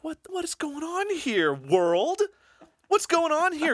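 way up high, indignant yelling at the world; mock outraged cry. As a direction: squeal it out like a cartoon complaint. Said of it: "And I was like".

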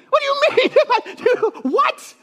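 high-pitched comic disbelief; incredulous. Acted. Preacher as a disciple answering Jesus back, incredulous. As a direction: squeal it through a laugh, baffled. Mock reply to Jesus' question.

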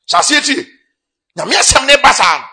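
high, loud exhortation; preaching shout. Real, not acted. Preacher urging the congregation to hear God's word, shouting. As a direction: call it out at full force.

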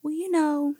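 high childish voice, mock heartbroken; hammed-up little kid pouring out her heartbreak; little girl's voice. Little girl complains to her teacher that her friend won't play, high mock-sad child voice.

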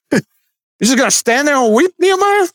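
high, incredulous mock question; playful disbelief, voice jumping up high. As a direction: scoff at the idea like a sceptic.

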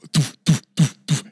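loud thudding doof noises; loud mock crash sounds; imitated thuds. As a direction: punch out each doof like a car hitting barrels. Imitating hitting barrels.

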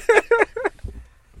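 cracking up at own joke; high burst of laughter. Real, not acted.

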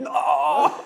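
a high, loud, half-laughing mock scream; mock primal scream. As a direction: howl the no like a wounded hero, laughing. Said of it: "a primeval scream".

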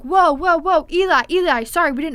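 high, loud, alarmed apology; startled shout. Retold or imitated. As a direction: back off fast and loud. Boys startled, apologizing to hobo.

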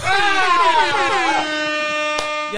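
long, high, loud squeal of laughter; helpless high-pitched laughing shriek. Real, not acted. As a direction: laugh till it turns to a squeal. Cracks up at own joke.

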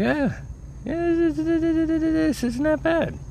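very high falsetto, squeaking out forced calm; strangled high-pitched mock courage; squeaky fake bravery. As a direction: pretend it doesn't hurt, voice shooting way up. Faking bravery during nose swab.

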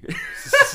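high airy laugh; real amused giggle; burst of laughter. Real, not acted. Laughing at the bit.

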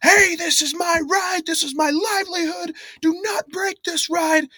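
high, far-off mock yell of an angry carny; hammed-up high scream of a ride operator; carny yelling at kids on his ride, mock screamed high. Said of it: "he just starts screaming".

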